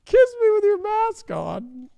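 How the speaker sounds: high, slow swooning plea; mock Jennifer. Mocking Jennifer begging Ben for a kiss, high and swooning. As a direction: coo it like a lovestruck starlet.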